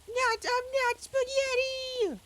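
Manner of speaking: high, loud, slow outraged yelp; protesting yell. Retold or imitated. Luigi protesting as Bowser eats him, high slow yelp. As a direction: shout it while being eaten. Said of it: "I'm like".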